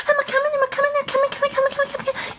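very high cartoon voice for a toy; tiny high-pitched chirpy voice; squeaky pet voice.

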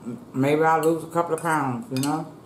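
food in mouth; speaking between bites; talks while chewing. Real, not acted. Seen 2: Food forked to mouth at -2; she keeps chewing while speaking after.

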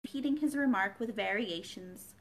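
usual speaking voice

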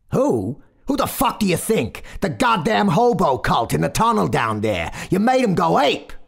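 high, loud Brooklyn motorman tirade; furious berating. A narrator's reading. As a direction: throw the question back, loudly incredulous.

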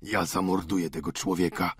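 calmly. A narrator's reading. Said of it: "powiedział ojciec Goriot spokojnie".

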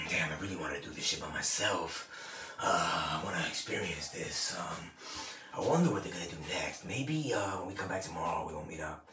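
low, soft, murmured thoughts to himself; hushed inner voice. Retold or imitated. Recalling wanting to be alone at the event, a low hushed inner voice. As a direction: think it aloud, low and hushed. Inner voice while fighting company.

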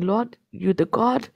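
ordinary talk, nothing marked

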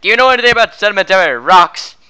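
loud salesman pitch; shouting. Acted. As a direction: shout the question like an infomercial. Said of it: "why are you shouting everything".

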